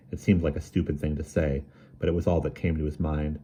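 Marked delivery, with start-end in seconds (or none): none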